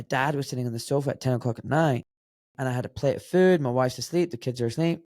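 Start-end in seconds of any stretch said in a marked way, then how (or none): none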